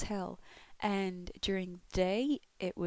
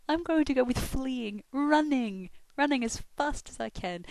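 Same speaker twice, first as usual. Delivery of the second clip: higher, louder, eager answer; gleeful about fleeing; excited; 5 semitones higher, 5 dB louder than usual. Real, not acted. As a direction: proudly declare you'd run. Excited about running away.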